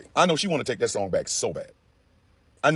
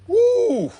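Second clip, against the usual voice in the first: loud high-pitched whoop; whooping in disbelief; 6 dB louder than usual. Real, not acted. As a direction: let out a shrill woo. Whoop of amazement.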